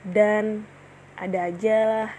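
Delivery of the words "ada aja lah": ordinary delivery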